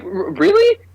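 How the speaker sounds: high-pitched, stammering mock disbelief; retold incredulity. Viewer mocking a gun pulled on a vampire, retold incredulity.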